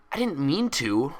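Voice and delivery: snapped retort. A narrator's reading. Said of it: "Twilight snapped".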